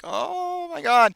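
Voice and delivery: high, drawn-out, loud mock wail; mock tearful. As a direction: imitate an overwhelmed brother in tears. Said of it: "he's in tears".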